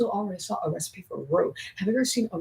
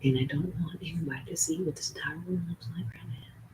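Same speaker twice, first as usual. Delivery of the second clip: whisper; 7 dB quieter than usual. Real, not acted. Doesn't want room seen.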